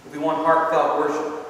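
normal talking voice

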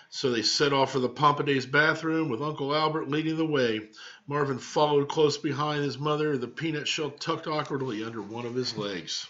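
usual speaking voice

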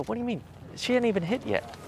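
high-pitched retold disbelief; incredulous objection. Disputes Tiger's early verdict.

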